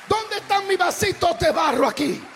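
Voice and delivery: loud, high impassioned preaching over the music; preaching at full force. Acted. Preacher urging the congregation on, preaching at full force. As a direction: pour it out like a revival preacher.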